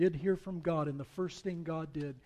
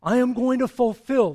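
thundering judgment; 8 semitones higher, 16 dB louder than usual. Acted. God pronouncing judgment on the house of Eli, thundering. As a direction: boom it out like a divine verdict.

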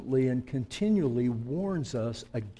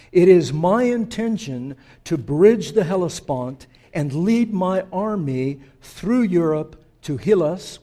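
commanding king; 4 semitones higher, 7 dB louder than usual. Acted. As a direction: boom it out like an emperor addressing his court. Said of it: "That's how these kings would move when they talked like this".

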